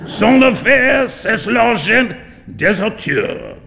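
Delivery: high, drawn-out exclamations; roaring amusement. A narrator's reading. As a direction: boom it out with theatrical relish, slowing into the French. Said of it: "he burst into a roar of laughter".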